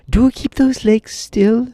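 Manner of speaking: high-pitched Tip, drawn out; high slow boy. A narrator's reading. Tip answering the puzzled horse, slow high voice.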